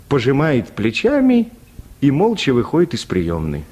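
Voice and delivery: normal talking voice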